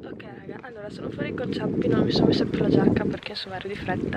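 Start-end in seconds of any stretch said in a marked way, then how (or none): 0.0-1.9 whispering. Real, not acted. Whispering outside.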